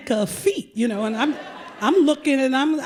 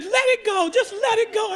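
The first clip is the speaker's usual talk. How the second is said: much higher, coaxing chant; mock soothing urge; 11 semitones higher than usual. Pedicurist urging the client on, high coaxing. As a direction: egg her on to surrender.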